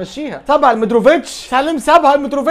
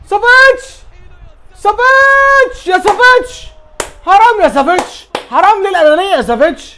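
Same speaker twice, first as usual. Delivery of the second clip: frustrated shout; 8 semitones higher, 6 dB louder than usual. Real, not acted. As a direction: yell at the selfish player. Savic dribbles, doesn't pass.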